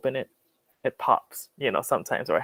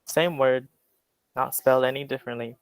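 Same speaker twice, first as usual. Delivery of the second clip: usual speaking voice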